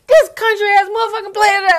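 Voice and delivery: high, loud, half-crying mock rant; ranting meltdown. Retelling the anchor cursing the countryside, loud wailing rant. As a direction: lose it completely, wailing curse. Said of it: "he just loses it".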